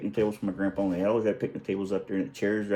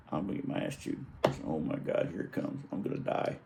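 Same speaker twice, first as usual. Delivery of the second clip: whispered, mock-doomed dread; hushed, half-joking whisper of dread; 4 semitones lower, 10 dB quieter than usual. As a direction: whisper it like doom is coming. Retold dread before mom.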